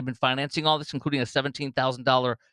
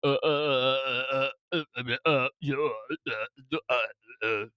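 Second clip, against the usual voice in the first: halting string of uhs, lightly mimicked; mock stammering; 3 dB quieter than usual. Host mocking the governor's answer to an interviewer, faltering stammer. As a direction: imitate a tongue-tied politician fumbling for words.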